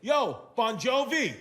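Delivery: loud, high, slow call across the room; loud wrong-name call. Retold or imitated. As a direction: hail him boldly with the wrong name. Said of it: "he goes".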